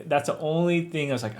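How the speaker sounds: usual speaking voice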